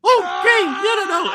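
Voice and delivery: very high, loud mock falsetto; high woman impression. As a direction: imitate a flighty woman in squeaky falsetto. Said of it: "and then she's like".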